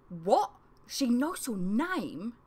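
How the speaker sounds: slow, rising disbelief; incredulous demand; surprised demand. A narrator's reading. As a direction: drag out the shock, a touch high. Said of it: "Talga demanded".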